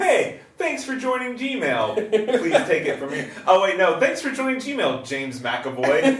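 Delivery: bright, sing-song, overly friendly robot; cheery automated greeting. Pretend. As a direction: read it like a chipper welcome message. Reading out Gmail welcome email.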